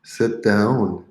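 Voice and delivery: ordinary delivery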